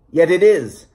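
plain reading voice

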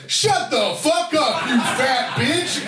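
high, slow yelled insult; blurted shout. Retold or imitated. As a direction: blurt it out at full volume. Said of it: "I stood up and I go".

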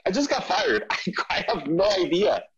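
high, laughing confession; laughing reply. Retold or imitated. Telling his friend about the job loss, high laughing. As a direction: laugh off the firing. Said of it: "I'm like".

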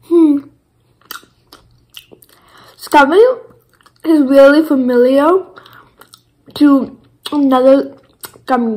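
talking around chewed candy; chewing while talking. Real, not acted. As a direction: mouth partly full. Seen 1: Closed pursed lips with chewing motion, candy table, speaking between chews.

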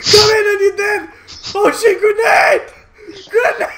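loud, high alarmed shouts; shouting. Real, not acted. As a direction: yell the warning. Shouting at enemies, grenade.

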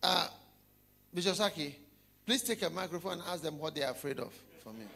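ordinary talk

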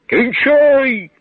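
loud, high threatening command; menacing shout. A narrator's reading. Ensign ordering the hangman, menacing loud shout. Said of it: "грізно крикнув прапорщик".

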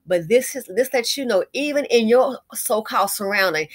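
ordinary talk

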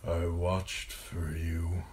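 slow, wispy dying father's voice; thin, pained murmur. A narrator's reading. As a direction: draw it out weakly through pain. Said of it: "thin and wispy and racked by pain".

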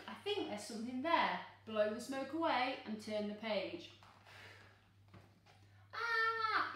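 plain reading voice

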